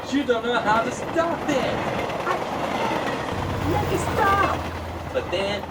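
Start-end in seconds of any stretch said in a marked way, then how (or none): 0.1-4.6 panicked cry. Acted. Heroine panics on the runaway cart.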